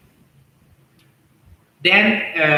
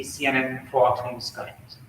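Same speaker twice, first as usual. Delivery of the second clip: normal talking voice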